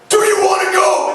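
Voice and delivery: high, loud mock challenge from a pumped-up fan; aggressive fan. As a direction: bark it like a drunk football fan itching to fight. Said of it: "And he's like".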